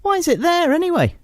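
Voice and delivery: indignant question. Retold or imitated. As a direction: demand it in disbelief.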